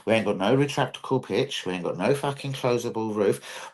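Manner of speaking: ordinary talk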